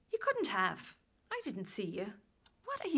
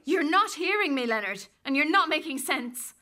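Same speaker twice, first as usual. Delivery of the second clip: louder, firm rebuke; firm raised voice; 4 semitones higher, 6 dB louder than usual. Acted. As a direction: raise your voice to get through. Rose firmly pushes back.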